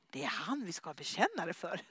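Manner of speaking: a quiet laughing realisation. Retold or imitated. Couple spot the plumber, quiet laugh. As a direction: giggle the idea out.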